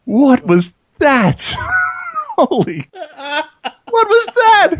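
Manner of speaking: high, drawn-out astonishment breaking into laughter; real startled disbelief. Real, not acted. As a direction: gasp it out, stunned and laughing. Startled by sudden loud noise.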